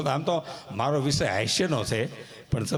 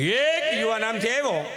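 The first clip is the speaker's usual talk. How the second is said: mock-excited storytelling; 8 semitones higher than usual. Comic retelling, young man arrives.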